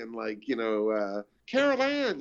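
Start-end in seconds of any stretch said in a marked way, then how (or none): 1.5-2.2 a much higher, thin crackly voice like a radio; high imitation of a chattering toy's radio voice; staticky radio voice. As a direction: mimic a faint staticky toy broadcast. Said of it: "sounded almost like a radio voice".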